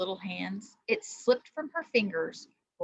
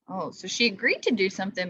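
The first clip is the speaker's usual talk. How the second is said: plain reading voice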